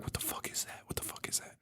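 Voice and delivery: barely audible, voiceless whisper; imitated whispering. Two people in a tent whispering, imitated in a hushed whisper. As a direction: whisper it like someone hiding. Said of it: "sitting there whispering and being like".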